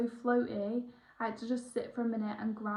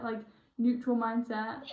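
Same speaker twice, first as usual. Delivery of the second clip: ordinary talk, nothing marked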